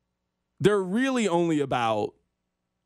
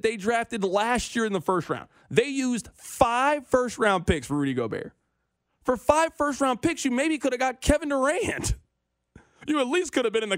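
high, rising disbelief; exasperated rant; 8 semitones higher than usual. Real, not acted. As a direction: push the outrage higher.

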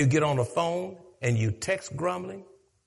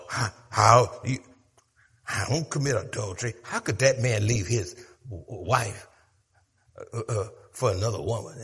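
low, fast, softer sniffy aside; a hint of holier-than-thou gossip; mock self-righteous churchgoer; 9 semitones lower, 4 dB quieter than usual. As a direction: imitate a smug saint, lightly. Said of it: "mimics self-righteous churchgoer".

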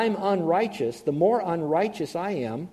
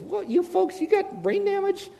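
much higher, mocking disbelief; incredulous Paul; 13 semitones higher than usual. Apostle Paul mocking foolish Christians in a high incredulous voice. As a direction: squeak it out like you can't believe them. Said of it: "he really speaks to Christians and he says".

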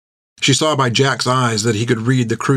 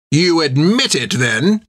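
stern captain; 5 semitones higher, slower than usual. A narrator's reading.

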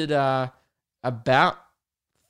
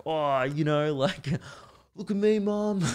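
put-on higher, teasing kid voice; mocking a rebellious kid; 4 semitones higher than usual. Podcaster mocking an underage drinker showing off to his mum, in a teasing kid voice. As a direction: show off to mum like a cheeky teen. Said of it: "It's just like".

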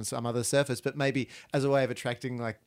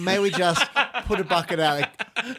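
higher, louder mock-posh English voice; posh English pharaoh; 4 semitones higher, 3 dB louder than usual. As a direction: play a haughty English pharaoh. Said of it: "Oh, the English pharaohs now".

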